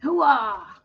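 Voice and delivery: gung-ho Pacino shout; Al Pacino hoo-ah. Acted. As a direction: bark it like Pacino. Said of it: "Is that Rocky?".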